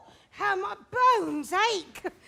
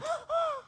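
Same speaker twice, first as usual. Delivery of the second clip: sharp gasp; 8 semitones higher, 4 dB quieter than usual. Acted. Nurse startled by wedding news.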